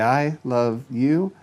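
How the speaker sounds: I love you stretched slowly; slowly drawn I love you. Shown as a demonstration. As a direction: draw it out slowly. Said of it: "So if you do the".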